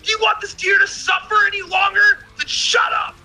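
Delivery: high retold angry yell; exasperated shouted retort; yelling at crowd. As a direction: snap back at the onlookers. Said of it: "I was like".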